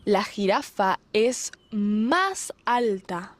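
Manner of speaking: ordinary delivery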